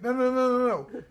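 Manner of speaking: high-pitched rapid no-no-no; imitated urgent protest. Athlete steps in as guard yells at photographer, imitated high protest.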